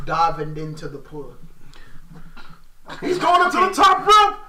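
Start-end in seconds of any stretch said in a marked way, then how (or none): 3.0-4.4 high, loud, slow shouted play-by-play; wrestling commentator. Pretend. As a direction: call the dive like a ringside announcer. Play-by-play of imagined move.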